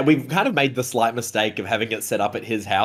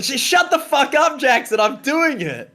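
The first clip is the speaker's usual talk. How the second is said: squeaky yelp at a friend; breaking into a high protest; high-pitched outburst; 12 semitones higher than usual. Real, not acted.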